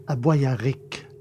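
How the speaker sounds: ordinary talk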